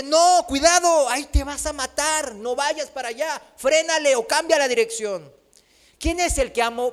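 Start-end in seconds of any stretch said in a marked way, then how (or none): 0.0-5.3 urgent warning shout. Retold or imitated. Preacher voices a friend yelling a warning at someone nearing the cliff, urgent shout. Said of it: "lo agarra y le dice".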